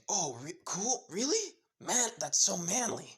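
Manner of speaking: really jumps way up high and loud, then stays bright; excited. Acted. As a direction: gush like an eager fan. Kirishima thrilled Barrett is a father.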